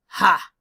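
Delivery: loud, sharp triumphant ha; gloating ha. Acted. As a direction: crow over your clever plan. Farmer gloating over his plan.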